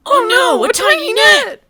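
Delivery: high, loud hammy panic; mock panic. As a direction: shriek it in fake terror.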